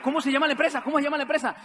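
loud, high, eager question; urgent demand. Retold or imitated. César demands company name from brother, urgent.

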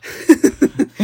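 high, breathy laugh bursting out; sudden loud laugh; loud burst of laughter. Real, not acted. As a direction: laugh out loud at your own joke. Laughing at own joke.

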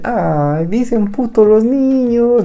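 high, slow, charmed mock tone; a hint of cooing over cute kids; lightly voiced charmed crowd.

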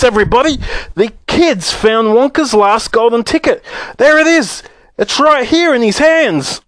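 very high, frantic shopkeeper yelling; shrieking with excitement; excited screaming. A narrator's reading. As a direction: holler it to everybody as if about to have a fit. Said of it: "screamed the shopkeeper".

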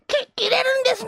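high shrieking pitch; screaming salesman. Pretend. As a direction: yell it like a wild demo seller. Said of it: "実演販売。".

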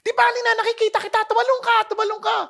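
high, loud, fast urging; urgent father. Retold or imitated. Father below the fire urging his child to jump, urgent and quick. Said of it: "Sabi niya".

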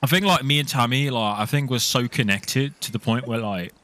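lads' banter in a fake Liverpool accent; cheeky roadman Scouser, lightly put on; put-on Scouse accent. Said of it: "props to you, [name]. That was good".

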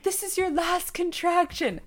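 calm her down kindly; husband reassuring his wife in labor, gently; soothing husband. Retold or imitated. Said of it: "he's like".

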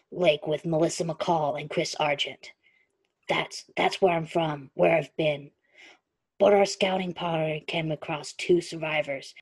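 low, steady storytelling voice; story narration. A narrator's reading. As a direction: read it like an audiobook. Reads the story aloud.

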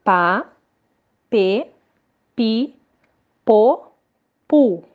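Pá, pê, pi, pô, pu read out one by one with pauses; syllable drill. Shown as a demonstration. Teacher reads the syllables for the class to repeat. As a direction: spell out each syllable for the kids to repeat. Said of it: "Mais uma vez".

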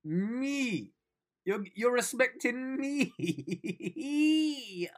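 high, slow, laughing disbelief; inner excited squeal. Retold or imitated. Vlogger reliving his inner reaction to being respected. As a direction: stretch it out, almost laughing. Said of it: "inside I was like".

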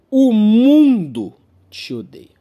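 loud and slow, each word weighed; dramatic slow proclamation. Real, not acted. Comedian to audience, a slow loud dramatic verdict. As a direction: declare it like a grim verdict. Punchline said twice.

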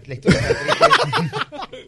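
slow laughing lament; drawn-out mock-sad, breaking into laughter; mock tragic. Real, not acted. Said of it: "Es tétrico". (lamento burlón, lento y riendo)

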